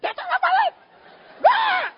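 very high, loud squawking freak-out; mock-hysterical squeal; comic panic. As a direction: shriek in hammed-up horror. Acts out horror at her crying.

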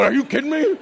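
high squeaky disbelief; incredulous squeak. Retold or imitated. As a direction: squeak it out in shock. Lying in bed, disbelief.

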